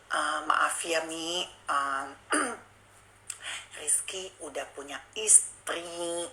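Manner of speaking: soft, slow, nearly whispered admission; hushed confession. Retold or imitated. Boyfriend confessing his wife to Mami, hushed and slow. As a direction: confess it under your breath, ashamed.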